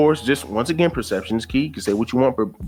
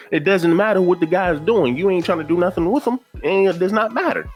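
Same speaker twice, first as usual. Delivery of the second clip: incredulous; 8 semitones higher than usual. Real, not acted. Exasperated rant at woman.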